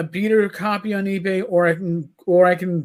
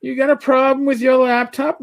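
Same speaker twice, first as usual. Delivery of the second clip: higher-pitched imitation of a questioner; raised, retold questioning voice; Rach's voice; 6 semitones higher, 3 dB louder than usual. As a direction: lightly mimic someone calling with a question. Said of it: "when I hear Rach go".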